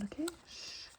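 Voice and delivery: usual speaking voice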